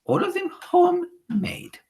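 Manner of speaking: slow, high gloating witch voice; fiendish leer. Acted. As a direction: savour the joke with a wicked grin. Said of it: "with a fiendish leer".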